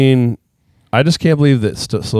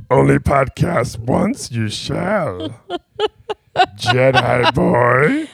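Yoda voice laughing; 3 semitones higher, much slower than usual. Pretend. Host answers in Yoda voice, slow and laughing. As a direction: drag it out like old Yoda, giggling. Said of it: "can you talk like Yoda".

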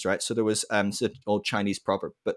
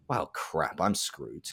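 low, softer, deflated aside; mock dismay; 6 semitones lower, 7 dB quieter, much slower than usual. Rob Bell facing a hard-line opponent on TV, quietly deflated. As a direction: sink as you realise you're beaten. Rob Bell's inner reaction.

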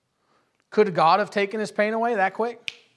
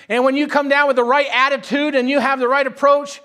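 higher, louder sermon delivery; fervent preaching; 7 semitones higher, 7 dB louder than usual. Real, not acted. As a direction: press on in a driving preacher's run.

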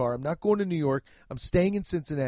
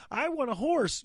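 mock whiny customer; 9 semitones higher than usual. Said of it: "when you see people like".

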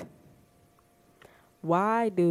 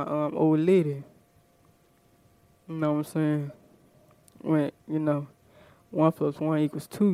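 slightly lowered, laid-back put-on man's voice; imitated boyfriend voice; 3 semitones lower than usual. Man asking his woman for favors, in an imitated boyfriend voice. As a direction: play a man casually sweet-talking his woman.

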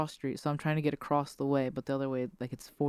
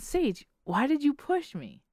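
high, drawn-out mock-startled question; 9 semitones higher than usual. Driver to Sage after feeling a jolt, startled question. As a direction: act out baffled accusation, pitch leaping up.

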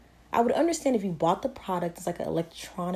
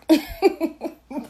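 short loud burst of laughter; 10 semitones higher, 7 dB louder than usual. Real, not acted.

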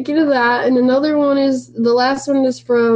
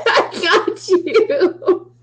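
high, drawn-out giggly delivery; real laughter breaking into the words; laughing; 9 semitones higher, much slower than usual. Real, not acted. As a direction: say it through a slow, high giggle. Cracks up teasing co-host.

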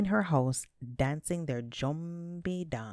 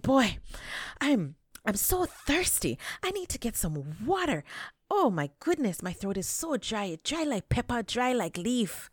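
mock thirsty rabbit; 7 semitones higher than usual. A narrator's reading. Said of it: "He said".

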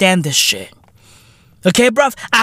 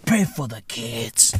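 quiet; 11 semitones lower, 10 dB quieter than usual. Real, not acted. Sudden low mutter.

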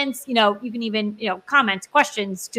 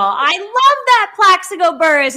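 imitating mom; 8 semitones higher, 4 dB louder than usual. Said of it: "is what she would say".

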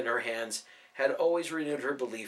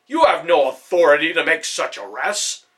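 loud, outraged protest; furious protest; 3 semitones higher, 11 dB louder than usual. A narrator's reading. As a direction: throw the challenge in his face. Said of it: "Hannibal cried".

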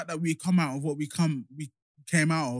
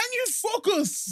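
exasperated; slower than usual. Real, not acted. Friend distracted by football score.

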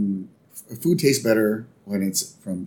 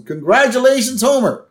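higher, chirpy neighbour voice; higher Flanders impression; Ned voice; 6 semitones higher than usual. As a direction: toast him with sunny cheer. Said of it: "He goes".

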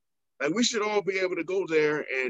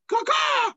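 raven caw. Retold or imitated. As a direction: call out like a cawing raven. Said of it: "You kept saying to people".

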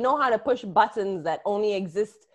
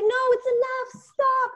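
grandma pleading; 12 semitones higher, slower than usual. Retold or imitated. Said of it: "I'd be like".

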